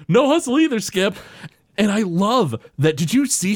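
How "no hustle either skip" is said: eager player. Retold or imitated. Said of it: "Daryl Strawberry's like".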